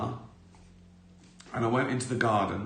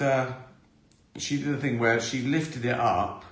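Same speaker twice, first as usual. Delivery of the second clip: ordinary talk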